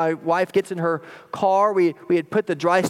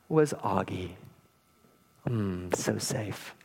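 much lower and softer, slowing at the end; hushed relief; 9 semitones lower, 9 dB quieter, slower than usual. Retold or imitated. Father tells of finding his lost son safe, hushed relief. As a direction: drop right down into quiet relief.

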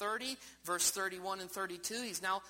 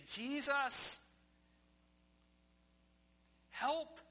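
higher, pleading cry to Jesus; desperate plea; 10 semitones higher, 3 dB louder, much slower than usual. Retold or imitated. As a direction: call out for help as a desperate sinner. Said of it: "If they just say".

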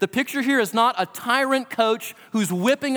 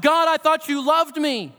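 higher, pleading cry of a sufferer; a touch of a hurt cry lifted up to God; anguished cry to God; 7 semitones higher than usual. Retold or imitated. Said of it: "That we're screaming, we're crying".

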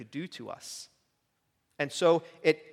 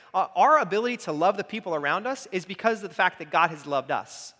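ordinary delivery

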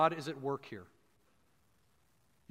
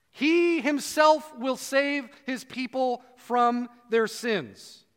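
He himself lifted higher, slowly; He himself raised high and drawn out; emphatic he himself; 4 semitones higher, much slower than usual. Shown as a demonstration. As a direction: lean hard on He himself. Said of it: "it's emphatic about he".

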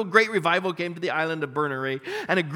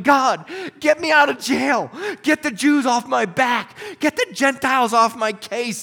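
loud, slow mock complaint; mock self-centred prayer, shouted; mock pleading shout; 4 semitones higher, 6 dB louder than usual. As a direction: ham up a whiny demand. Mock selfish prayer, [name] not praying.

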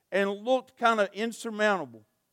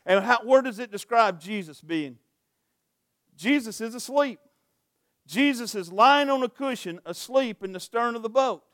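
ordinary talk

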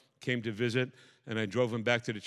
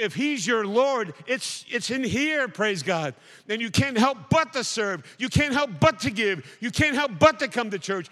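loud, higher, building sermon cry; fiery preaching; 8 semitones higher, 5 dB louder than usual. Acted. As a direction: preach it hard to the congregation. Preaching at full force again.

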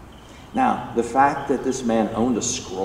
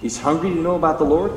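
ordinary talk, nothing marked

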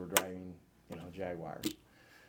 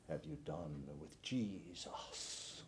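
slow, low Norwegian grandpa voice; heavy Norwegian accent, drawn out; 4 semitones lower, 8 dB quieter, much slower than usual. Retold or imitated. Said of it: "in his thick Norwegian accent".

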